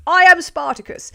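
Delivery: high, loud film-hero declaration; mock rallying cry. Streamer quoting Spartacus from a donor's message, mock heroic shout. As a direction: call it out like a rebel standing up.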